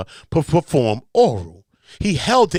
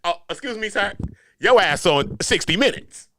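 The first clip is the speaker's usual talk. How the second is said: polite interviewer voice; 6 semitones higher than usual. Pretend. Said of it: "I'll be like this".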